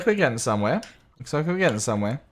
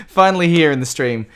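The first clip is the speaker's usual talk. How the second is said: laughing; 7 semitones higher, 10 dB louder than usual. Real, not acted. Relief after setup trouble.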